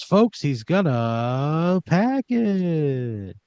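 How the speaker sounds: host milking the moment for the audience; game show host. Acted. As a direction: stretch it out to work the crowd. Host announces to crowd.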